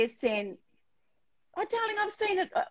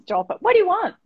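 ordinary talk

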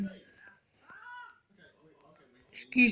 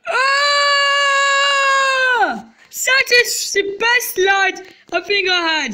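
high, loud thrilled cry; excited scream; 11 semitones higher, 5 dB louder, much slower than usual. Real, not acted. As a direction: scream it with delight. Screaming down the slide.

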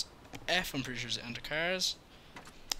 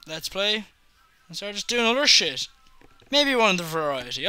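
ordinary talk, nothing marked

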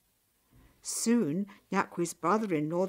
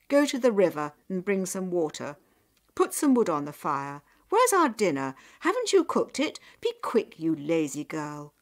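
loud, impatient orders; nagging, raised-voice scolding, climbing in pitch; scolding parents; 8 dB louder than usual. A narrator's reading. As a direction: rattle off sharp commands at the daughter.

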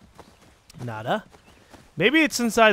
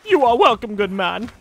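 mock gentleman; 4 semitones higher, 6 dB louder than usual. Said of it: "Oh, I wish I had an accent".

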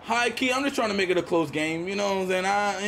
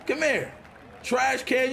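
ordinary talk, nothing marked

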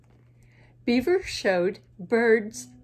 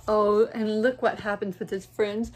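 ordinary delivery

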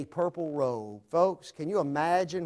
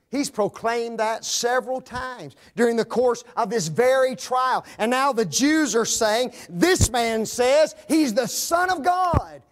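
raised, loud pulpit voice proclaiming scripture; full force preaching; 6 semitones higher, 6 dB louder than usual. Acted. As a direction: ring it out to the back pews. Preacher building to accusation.

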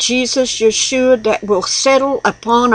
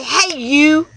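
raised, pointed call-out; a touch of a challenge, pitched higher; mock confrontation; 5 semitones higher than usual. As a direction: call someone out, half in jest. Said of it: "I just want to go out there and say".

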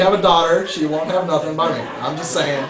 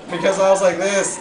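usual speaking voice

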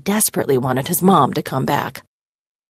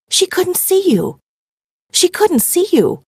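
higher and slower, mounting shock; rising disbelief; 12 semitones higher, 4 dB louder than usual. A narrator's reading. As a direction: let the realization grow louder. Realization repeated, building.

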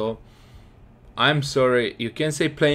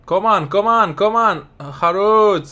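louder, higher urging chant; excited come on; cheering on; 5 semitones higher, 6 dB louder than usual. Real, not acted. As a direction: push them forward with your voice. Cheering the attack on.

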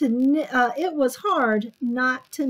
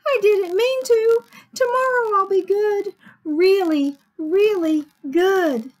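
high, loud, earnest little Sammy; pleading promise; 9 semitones higher, 7 dB louder than usual. A narrator's reading. Sammy promising he'll be good tomorrow, pleading. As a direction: plead it, leaning on each really.

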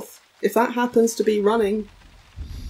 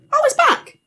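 startled; 4 dB louder than usual. Real, not acted. Sudden scare in horror game.

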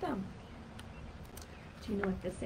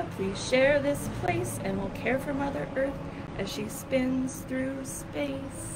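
slow grandpa refrain; 4 semitones higher, 5 dB louder, slower than usual. Acted. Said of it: "said my grandpa".

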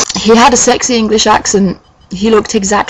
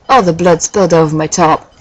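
ordinary delivery

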